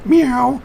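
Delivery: high squeaky meow; cat meow. Shown as a demonstration. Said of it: "if I go".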